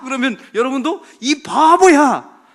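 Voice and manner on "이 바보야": high, very loud, drawn-out taunt; mock insult shout. Preacher prompting the congregation to copy him, drawn-out mock shout. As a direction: stretch the insult into a big mock yell.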